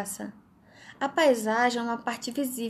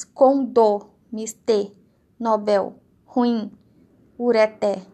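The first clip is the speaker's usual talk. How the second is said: "Condô, mister, nobel, ruim, ureté" stressed loudly on the last syllable; tônica na última sílaba; 7 dB louder, slower than usual. Shown as a demonstration. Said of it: "Oxítonas, que são as últimas sílabas tônicas". ("Condô, mister, nobel, ruim, ureté" com força na última sílaba)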